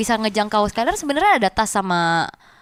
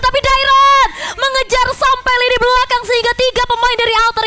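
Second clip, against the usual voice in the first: high, driving esports hype call; play-by-play commentary; 8 semitones higher, 4 dB louder than usual. Acted. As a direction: build the teamfight call to a peak.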